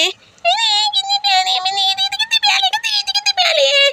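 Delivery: put-on very high, lisping baby voice; delighted toddler. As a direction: squeal like a tiny child at new toys. Voices little brother Hasnain.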